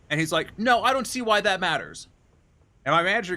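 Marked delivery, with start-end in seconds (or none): 0.6-2.1 higher-pitched mock of a stubborn customer; argumentative. Customer to staff insisting regulations matter, argumentative. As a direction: imitate someone pushing back, pitched up.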